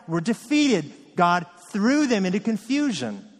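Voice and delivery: rising, insistent gospel appeal, pressing each phrase; preaching at full force. Acted. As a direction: preach it hard to the church, driving every line home. Preacher applies gospel to congregation.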